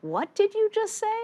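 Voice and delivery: imitating the boss's shocked challenge; stunned disbelief. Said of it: "he looked at me like".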